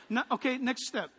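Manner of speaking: ordinary delivery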